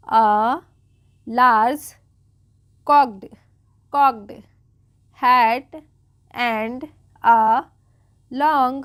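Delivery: one word at a time, firmly projected; slow read-aloud. Shown as a demonstration. Teacher reading the story to beginners, slow separated words. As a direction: dictate each word with a long pause after. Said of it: "बिल्कुल आराम आराम से पढ़ रही हूँ".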